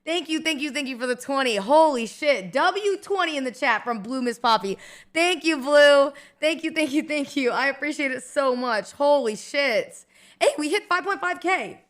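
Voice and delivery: high, gushing streamer thanks; excited thanks. Real, not acted. Streamer thanks a viewer for twenty, excited. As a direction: thank them in a loud, rising burst of hype.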